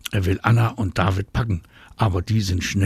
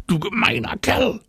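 much higher, narrated angry yell; indignant scream; 12 semitones higher than usual. A narrator's reading. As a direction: shriek the accusation like a furious child. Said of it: "schreit Anna".

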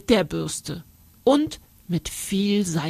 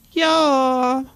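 put-on child calling back; 6 semitones higher, 4 dB louder than usual. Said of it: "ruft Karlchen".

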